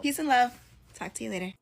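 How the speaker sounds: ordinary talk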